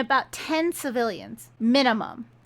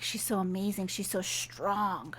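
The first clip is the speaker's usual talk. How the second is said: dreamy; 3 semitones lower, 8 dB quieter than usual. Retold or imitated. Smitten man describes her.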